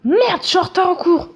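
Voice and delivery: high, loud hammed-up alarm; exaggerated panicked outburst; mock panic. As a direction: cry out as if suddenly late. Acted panic about being late.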